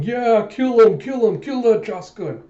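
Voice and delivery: high, loud bloodthirsty cheering; rowdy spectator. Retold or imitated. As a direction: egg the fighter on from the stands. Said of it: "another guy in the audience going".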